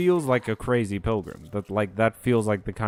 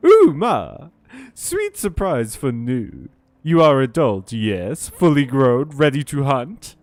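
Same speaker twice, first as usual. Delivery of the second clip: excited Nu voice; 3 semitones higher, 5 dB louder than usual. Acted. Voicing the excitable character Nu.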